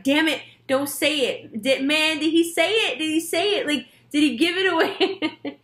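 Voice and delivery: high, urgent mock panic; flustered imitation pitched well up; panicked Scorpio. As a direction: squeak it like a Scorpio afraid the secret is out. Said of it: "Scorpios are like".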